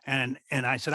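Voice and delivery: ordinary talk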